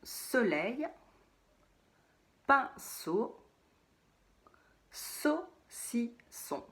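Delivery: saucisson stretched slowly, one beat per syllable. Shown as a demonstration. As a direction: tap out each syllable of saucisson. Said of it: "dans ces trois mots, il y a une syllabe". (saucisson détaché lentement, syllabe par syllabe)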